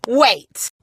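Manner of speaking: normal talking voice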